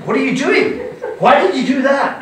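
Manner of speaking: high, shocked protest of a slapped friend; indignant friend. Retold or imitated. Slapped South African friend protesting to the host, high and shocked. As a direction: squeak it out, stung and bewildered.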